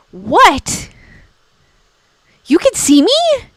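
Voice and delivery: hammed-up shock at being seen; mock ghost surprise. Shown as a demonstration. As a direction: play the startled ghost, pitch leaping up. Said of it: "the look on their face would be so hilarious".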